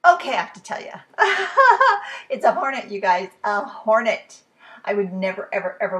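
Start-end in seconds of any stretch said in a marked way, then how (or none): 0.0-4.4 loud laughing announcement; laughing reveal. Real, not acted. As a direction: burst out the answer laughing.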